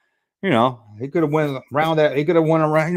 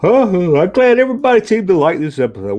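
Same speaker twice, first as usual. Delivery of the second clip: talking through a yawn; 5 semitones higher, 5 dB louder than usual. Real, not acted. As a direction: push the words out loudly mid-yawn. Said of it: "Why am I keep yawning?".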